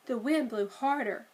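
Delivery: usual speaking voice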